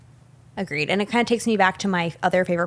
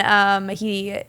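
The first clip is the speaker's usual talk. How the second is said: ordinary talk, nothing marked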